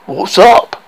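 loud, brash greeting; cocky hero. Acted. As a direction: swagger into the room. Buff hero greets the queen.

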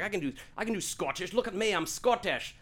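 hammed-up Scottish accent. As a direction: do a comic Scottish brogue. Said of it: "I do Mike Myers doing Scottish".

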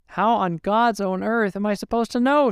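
wife crying out to her aunt in disbelief. A narrator's reading. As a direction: protest through tears of hurt. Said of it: "she cried".

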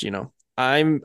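ordinary delivery

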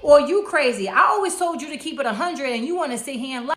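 retold scolding of her cheating man; angry comeback. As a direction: confront the lying boyfriend hard. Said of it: "I'm like".

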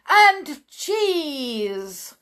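high, loud, drawn-out exclamation; witch's shout. Acted. Witch topping her followers' cry, loud gleeful shout. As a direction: shout it with accented relish. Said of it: "shouted the Grand High Witch".

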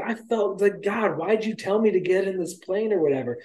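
high-pitched retold lament; acted-out exasperated questioning; exasperated complaint. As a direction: complain upward in mock despair. Rick complains to God.

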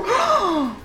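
sharp high gasp of shock. Retold or imitated. Shocked reaction to story. (suspiro chocado e agudo)

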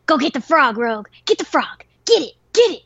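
egging the dog on. Retold or imitated. Urging dog to get frog.

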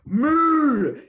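loud, high hammed-up moo; mock cow moo. Co-host mocking the host with a cow moo. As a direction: moo like a bull.

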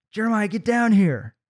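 high, sharp mother's command; mom ordering. Retold or imitated. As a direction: order him down like an exasperated mom.

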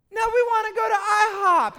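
put-on loud, high-pitched kid whine-shout; protesting kids. Kids answering parents about breakfast plans, loud mock protest. Kids' protest in a retold scene.

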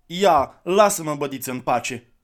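put-on loud, high child's snap; a boy pushing the man away; irritated boy. Boy snaps at the stranger.

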